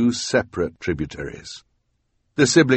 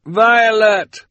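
shouting across water; 13 semitones higher, 7 dB louder than usual. A narrator's reading. Calling out across rushing water.

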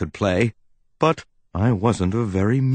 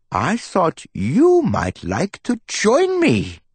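professor voice. Acted. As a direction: build wonder over the hidden ruby. Professor tells of the ruby.

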